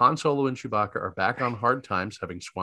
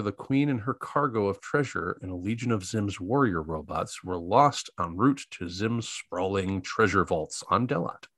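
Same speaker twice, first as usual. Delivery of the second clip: ordinary talk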